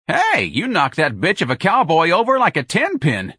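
high, loud delighted yell; admiring scream. Acted. Frida cheering Ignatius for toppling the cowboy, loud admiring yell. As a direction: cheer him on like a brawler. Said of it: "Frida screamed admiringly".

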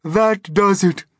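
slow, high, loud wail; despairing cry. A narrator's reading. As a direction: cry out slowly in high dismay. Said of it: "cried the earthworm".

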